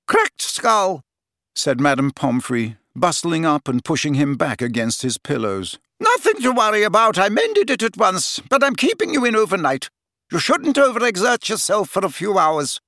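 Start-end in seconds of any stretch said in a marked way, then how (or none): 0.1-1.0 high, brisk nurse; brisk matron voice. A narrator's reading. Madame Pomfrey speaking. 6.0-12.8 high, brisk nurse; brisk matron voice. A narrator's reading. Madame Pomfrey speaking.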